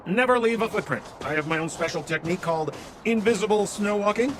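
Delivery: ordinary talk, nothing marked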